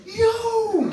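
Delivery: very high-pitched, drawn-out yo; excited kid's call. Acted. Boy telling his younger brother news, excited call. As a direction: imitate a thrilled child calling out.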